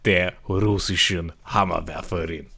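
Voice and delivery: lightly put-on Russian accent; low mock Russian voice. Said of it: "einen anderen Dialekt zu machen".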